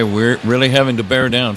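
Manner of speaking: ordinary talk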